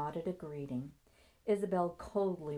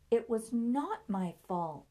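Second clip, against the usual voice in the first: slow, high, loud "not my fault"; "not" hit hard in indignant protest; firm denial; 6 semitones higher, 5 dB louder than usual. A narrator's reading. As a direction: deny it with weight on "not". Nancy rebuts the accusation.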